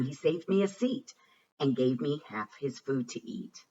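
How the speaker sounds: ordinary delivery, nothing marked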